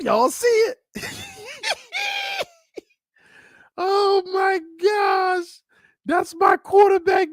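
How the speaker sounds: high, loud whooping hype; hype excitement. Real, not acted. Vlogger hypes his quarterback's workout video to viewers, whooping high and loud. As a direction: gush over the quarterback like a fan. Hyping the highlight clip.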